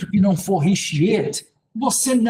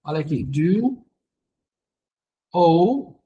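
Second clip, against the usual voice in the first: drawn-out words with long pauses; slow dictation; much slower than usual. Real, not acted. Teacher writing out a rule for students, slow dictation. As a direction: spell the rule out word by word, slowly.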